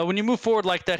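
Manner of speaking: ordinary delivery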